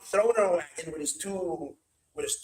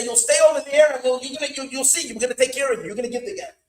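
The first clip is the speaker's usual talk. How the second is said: raised, insistent retold threat; acted-out bullying ultimatum; threatening; 5 semitones higher, 6 dB louder, faster than usual. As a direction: warn the prisoner forcefully. Retold threat to locked-up prisoner.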